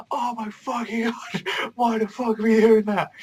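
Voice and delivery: high-pitched mock panic; shitting himself. Asta after the deadly fall, panicking in mock terror. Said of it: "genuinely fucking shitting himself".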